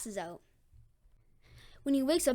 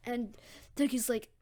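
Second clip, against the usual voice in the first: plain reading voice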